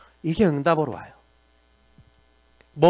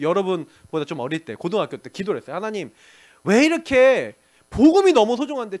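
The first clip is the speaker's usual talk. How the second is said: usual speaking voice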